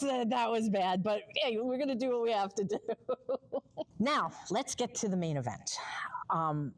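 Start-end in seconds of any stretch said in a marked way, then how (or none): 2.8-3.9 soft, high chuckle; laughing. Real, not acted. Co-host excusing her corny joke, soft laugh. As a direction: laugh it off softly.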